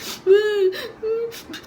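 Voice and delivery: high-pitched mock sobbing; imitated crying. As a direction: wail as if unable to stop crying. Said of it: "I could not stop crying".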